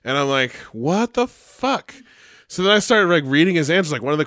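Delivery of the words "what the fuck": high, slow drawn-out disbelief; stunned disbelief. Retold or imitated. Teacher reading a student's report, slow high disbelief. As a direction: stretch it out, baffled. Teacher reacts to absurd homework.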